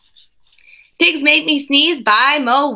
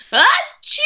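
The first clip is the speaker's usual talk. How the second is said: big sneeze; 13 semitones higher than usual. Acted. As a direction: throw out a huge sneeze. Gerald keeps sneezing.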